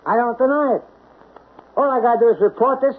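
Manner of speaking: usual speaking voice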